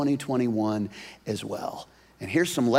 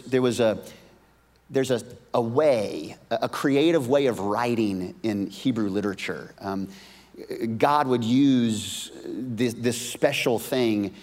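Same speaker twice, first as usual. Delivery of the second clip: ordinary delivery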